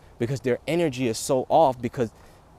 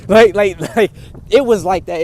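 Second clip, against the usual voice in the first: loud laughing burst, much higher; laughing loudly; 7 semitones higher, 13 dB louder than usual. Real, not acted. Telling about the pool video, laughing hard and loud. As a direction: crack up loud while explaining. Laughing while showing the face.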